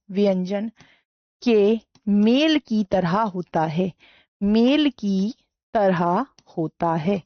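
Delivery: slow dictation, each phrase repeated after pauses. Shown as a demonstration. Teacher dictating visarga pronunciation to class, slow dictation. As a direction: let every word land for writing.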